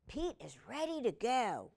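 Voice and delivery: ordinary delivery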